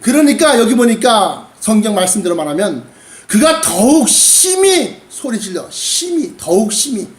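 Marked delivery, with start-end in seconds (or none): 3.6-5.0 더욱 심히 drawn out high and slow; emphatic intensity. Shown as a demonstration. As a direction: lean hard on 심히, stretching it. Acting out the Bible's louder cry. ("더욱 심히"를 높고 길게 강조)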